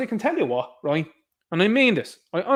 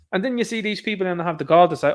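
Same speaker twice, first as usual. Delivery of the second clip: ordinary talk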